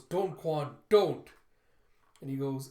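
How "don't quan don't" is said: rising to a loud desperate cry; pleading shout. Retold or imitated. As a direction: stop him with a yell, second don't loudest. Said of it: "And she goes".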